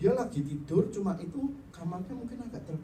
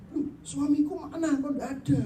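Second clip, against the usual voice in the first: panicked search; 12 semitones higher, 6 dB louder than usual. Retold or imitated. Wife finds husband gone.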